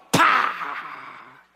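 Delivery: a sharp mouth-made slap, pah; slap sound. Acted. Pastor imitating a slap to the congregation.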